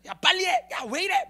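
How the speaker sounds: high, loud imitated boss barking at staff; shouting boss, hammed up to make a point; angry boss yelling. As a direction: snap at the workers like an irate boss. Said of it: "막 이렇게 화를 내잖아요".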